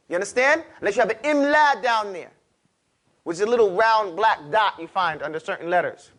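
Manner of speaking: normal talking voice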